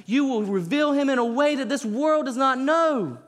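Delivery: high, loud fervent preaching; impassioned sermon climax; preaching at full force. Acted. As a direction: pour it out over the congregation.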